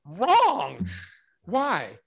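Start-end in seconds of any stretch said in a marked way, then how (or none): 0.0-0.9 loud, high "wrong" like a thunderclap; retold emphatic objection. Guest objecting to the sealing, emphatic as lightning. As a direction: strike the word like a lightning bolt.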